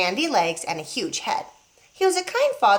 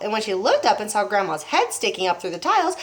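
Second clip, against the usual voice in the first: ordinary delivery, nothing marked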